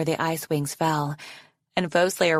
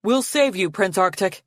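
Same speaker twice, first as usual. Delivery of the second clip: lightly raised calling voice; 2 semitones higher than usual. A narrator's reading. Teacher calling to the captive prince, calling out. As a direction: call across a distance, understated. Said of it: "called the voice of his teacher".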